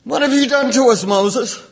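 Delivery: high, loud and fast accusing cry; a scared crowd turning on Moses; panicked crowd. Retold or imitated. Frightened Israelites blaming Moses, high loud panic. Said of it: "How did the people react?".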